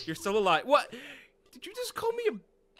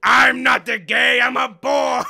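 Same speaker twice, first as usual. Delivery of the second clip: loud, slow declaration; mock shout; 10 dB louder, slower than usual. Gamer making a silly announcement to a friend, mock shouting. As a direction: bellow it slowly as a joke. Joke outburst during the game.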